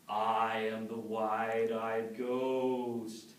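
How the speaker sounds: slow, drawn-out ghostly moan; haunt him, stretch the words; spooky ghost voice. Acted. Ghost creeping up behind Noah, slow spooky moan. The same voice comes and says.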